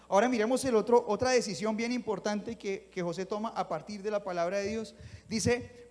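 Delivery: usual speaking voice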